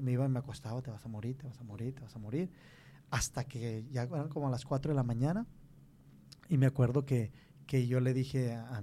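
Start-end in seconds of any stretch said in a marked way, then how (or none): 0.8-2.5 soft, repetitive inner voice; intrusive thought. Retold or imitated. A thought haunting him as he lies in bed, softly repeated. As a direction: murmur it over and over, much softer.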